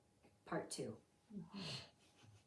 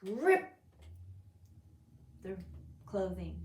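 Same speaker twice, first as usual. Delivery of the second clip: ordinary talk, nothing marked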